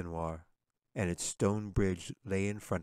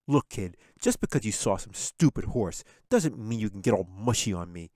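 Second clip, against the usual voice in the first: louder, sneering big brother; surly boy snapping at his sister; mean and pig-headed; 6 dB louder than usual. A narrator's reading. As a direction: brush off the little sister rudely. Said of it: "doing his best to be mean".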